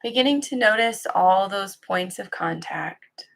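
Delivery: calm, even guiding voice with long pauses; guided meditation. Acted. Teacher settling listeners into practice, calm guided-meditation delivery. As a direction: ease listeners into stillness, leaving long silences between phrases.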